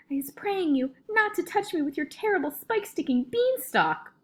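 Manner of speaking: high-pitched, panicked pleading; screamed begging. A narrator's reading. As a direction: beg for mercy in mounting terror. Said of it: "screamed the flesh lump eater".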